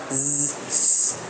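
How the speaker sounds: soft, low isolated "Z" buzz; hissing sibilant sound. Shown as a demonstration. Teacher demonstrating sibilants to class, hissing Z sound. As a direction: hold "Z" as a quiet sibilant, then repeat. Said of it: "or letter Z".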